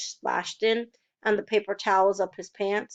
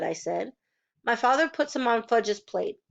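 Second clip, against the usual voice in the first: plain reading voice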